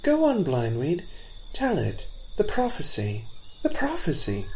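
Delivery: little ones pleading for the tale; eager fawns. A narrator's reading. Fawns begging for the prophecy.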